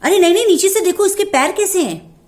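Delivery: high, loud urgent call; loud warning. Acted. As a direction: kid shouts to check the feet first. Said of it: "जोर से बोला". (ज़ोर से चेतावनी देता बच्चा)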